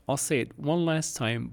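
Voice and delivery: usual speaking voice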